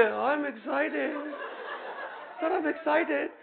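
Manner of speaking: slow, laughing, unconvincing; teacher mimics a lukewarm student; mock half-hearted answer. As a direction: drag it out like you're bored. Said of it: "You can't just say".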